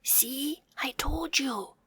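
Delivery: soft unvoiced whisper. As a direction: whisper an I-told-you-so. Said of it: "whispered the tiny bear".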